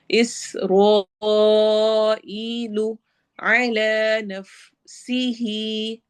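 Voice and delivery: slow, drawn-out tajweed chant; Quran recitation. Shown as a demonstration. Teacher recites verse for students, slow tajweed chant. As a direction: recite it slowly, stretching each sound. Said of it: "सीन में city वाली आवाज़".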